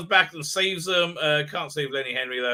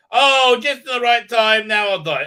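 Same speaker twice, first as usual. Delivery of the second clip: loud, high melodramatic farewell; overacted last words; mock dying words; 7 semitones higher, 9 dB louder than usual. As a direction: ham up a theatrical death. Acts out character's dying words.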